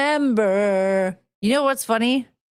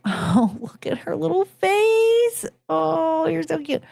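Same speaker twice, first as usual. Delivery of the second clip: overwhelmed by cuteness; 7 semitones higher than usual. Real, not acted. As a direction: melt over a cute little face. Cooing at pet's face.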